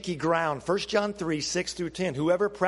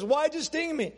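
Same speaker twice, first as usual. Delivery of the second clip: higher, hurt question from the frog; a frog asking why he was betrayed; stung frog crying out to the scorpion; 8 semitones higher than usual. Acted. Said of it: "the frog looks up to the scorpion and says".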